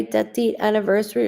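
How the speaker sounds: normal talking voice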